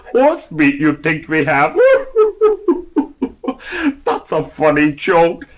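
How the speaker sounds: chuckling clerk voice; laughing through words. Acted. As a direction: laugh as you speak.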